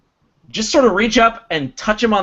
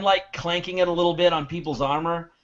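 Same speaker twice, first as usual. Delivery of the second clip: usual speaking voice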